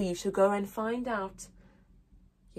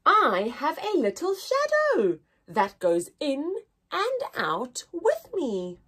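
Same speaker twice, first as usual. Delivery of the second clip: put-on poem reading to children. Teacher reading the poem aloud to her class. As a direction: read it gently with long pauses.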